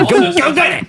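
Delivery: high, taunting voice; high teasing jeer; mocking dismissal. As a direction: sneer it like tossing scraps to a dog. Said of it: "throw the dollar and say".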